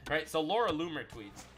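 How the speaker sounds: usual speaking voice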